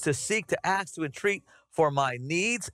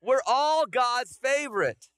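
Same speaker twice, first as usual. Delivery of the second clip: emphatic; 9 semitones higher, slower than usual. Real, not acted. Preacher affirming the congregation.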